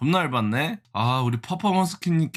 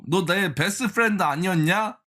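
ordinary delivery

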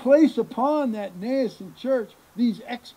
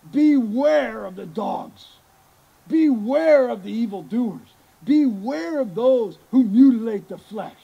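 emphatic warning; 7 dB louder than usual. Acted. Preacher voicing Paul warning the church, loud and emphatic. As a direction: thunder out each "beware" as a warning. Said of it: "underscore and make sure".

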